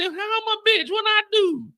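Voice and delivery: squeaky, high and hurt; mock-offended high voice; high indignant squeak. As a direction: protest in a high wounded voice. His indignant reply to the chat.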